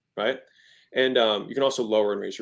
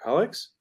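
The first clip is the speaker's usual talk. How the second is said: 'Alex' raised at the end as a question; questioning rise; 4 semitones higher than usual. Shown as a demonstration. Wife checking husband is there, rising question on 'Alex'. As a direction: lift the end of 'Alex' higher, as if asking. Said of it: "If I raise my voice at the end, it's a question".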